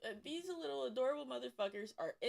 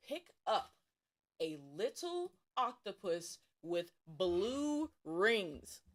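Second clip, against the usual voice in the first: slow, deliberate; much slower than usual. Real, not acted.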